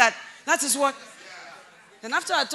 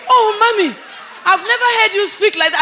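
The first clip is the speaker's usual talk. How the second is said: put-on young man's protest; 10 semitones higher, 13 dB louder than usual. Young man voiced in retold story.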